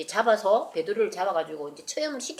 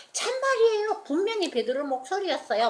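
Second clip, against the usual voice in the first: insisting; 10 semitones higher than usual. Acted. Rhoda insists to doubters.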